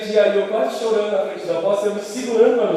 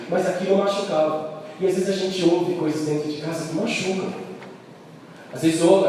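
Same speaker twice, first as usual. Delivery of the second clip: ordinary talk, nothing marked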